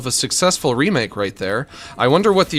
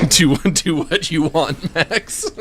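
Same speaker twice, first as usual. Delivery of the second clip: laughing; 2 semitones higher, slower than usual. Real, not acted. Laughing at viewer.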